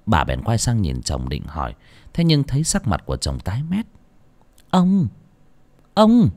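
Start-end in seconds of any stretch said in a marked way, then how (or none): 4.7-6.3 urgent call. Acted. Wife calling her frozen husband at dinner, urgent call. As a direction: call out to him, getting sharper.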